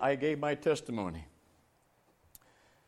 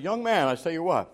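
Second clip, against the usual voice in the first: usual speaking voice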